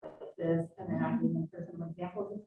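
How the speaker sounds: flat droning lecturer voice; monotone voice. Shown as a demonstration. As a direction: drone on with no tune. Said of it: "talking in a monotone voice".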